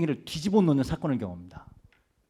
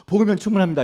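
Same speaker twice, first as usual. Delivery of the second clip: fast, loud, higher proclamation of faith; mock loud declaration; 6 semitones higher, 8 dB louder, much faster than usual. Believers loudly declaring the gospel is enough, mock shout. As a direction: belt it out like a rally cry, quickly. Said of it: "막 고래고래 소리 질러놓고".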